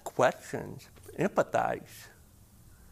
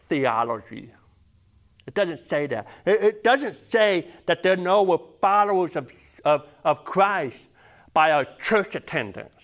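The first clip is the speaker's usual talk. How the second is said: rising sermon; 4 dB louder than usual. Acted. Pastor to church, preaching louder and building. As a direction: build the congregation to the verse.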